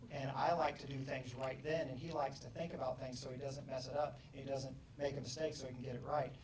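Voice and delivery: normal talking voice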